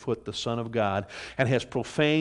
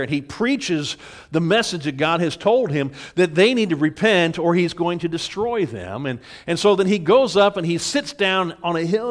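higher, louder pulpit voice; fervent sermon delivery; full-force preaching; 6 semitones higher, 6 dB louder than usual. Acted. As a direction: preach it out to the whole church. Preaching the Jonah story.

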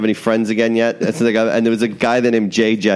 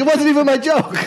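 laughing protest. Retold or imitated.

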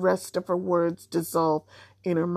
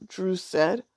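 ordinary delivery

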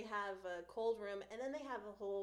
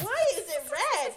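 alarmed retelling; 9 semitones higher, 15 dB louder than usual. Retold or imitated. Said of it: "I was like".

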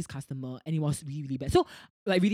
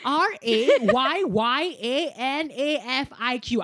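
slow spelled defiance; 6 semitones higher, 6 dB louder, much slower than usual. Retold or imitated. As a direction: spell the name out slowly, loudly. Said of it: "Then I said lah".

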